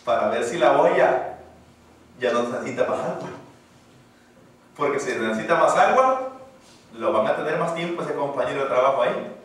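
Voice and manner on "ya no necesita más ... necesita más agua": usual speaking voice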